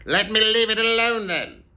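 high, loud, testy outburst; irritated retort. A narrator's reading. Scrooge snaps back at nephew.

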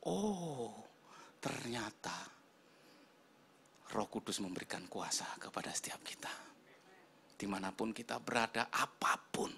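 soft, near-whispered revelation; hushed preaching. Real, not acted. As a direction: drop to an awed hush for the congregation.

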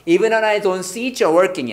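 "see it, you're" run together as "see-cher"; fast linked reading. Shown as a demonstration. Teacher shows how it sounds read quickly, linked pronunciation. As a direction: blend the T of "it" into "you're" as "ch". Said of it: "빨리 읽으면요".

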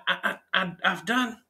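ordinary talk, nothing marked

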